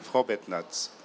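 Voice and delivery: usual speaking voice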